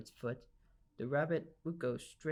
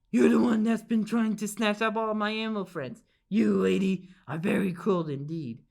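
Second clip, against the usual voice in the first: angry bear; 4 semitones higher, 7 dB louder than usual. Acted. Angry bear scolds the lady.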